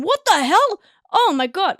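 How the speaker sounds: sudden loud disbelief; shocked exclamation. Real, not acted. Reading that the idiom meant spendthrift couples, shocked. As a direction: blurt it out, genuinely thrown.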